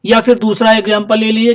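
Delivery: ordinary talk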